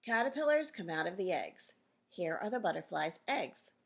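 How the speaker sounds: ordinary delivery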